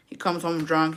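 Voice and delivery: ordinary talk, nothing marked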